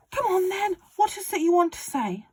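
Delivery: high, loud, gleeful father; excited Mister Cranky. Acted. Father urging George on about the medicine, high and loud. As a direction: crow it like a man with a scheme.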